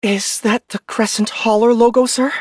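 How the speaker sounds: plain reading voice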